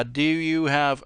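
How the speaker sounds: ordinary talk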